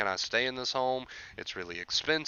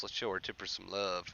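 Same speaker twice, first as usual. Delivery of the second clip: repeated much softer, almost to himself; a softer second pass at the shout-out; 4 semitones lower, 10 dB quieter than usual. Real, not acted. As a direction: echo the line quietly under your breath.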